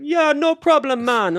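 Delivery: high, loud mock Jamaican accent; drawn-out, sing-out Jamaican imitation. Chatbot refusing to drop the voice, hammed-up Jamaican accent. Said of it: "I couldn't stop it doing a Jamaican accent".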